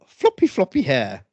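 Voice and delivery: mocking impression.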